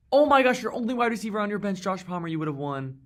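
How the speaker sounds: high, loud, fast exclamation; shocked outburst. Real, not acted. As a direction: cry it out in disbelief. Realizes the bench would have won.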